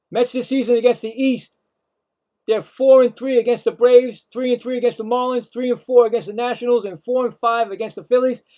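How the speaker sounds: normal talking voice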